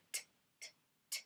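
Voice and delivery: quiet unvoiced ticking t; tennis t. Shown as a demonstration. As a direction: tick t back and forth like a ball. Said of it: "my head kind of makes this sound".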